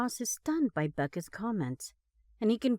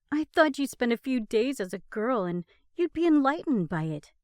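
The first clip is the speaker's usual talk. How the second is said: ordinary talk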